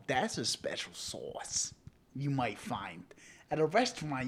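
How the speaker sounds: ordinary talk, nothing marked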